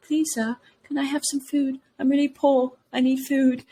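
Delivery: put-on high, pleading little child voice; begging poor kid. Podcaster mimics a poor kid begging Scrooge, high and pleading.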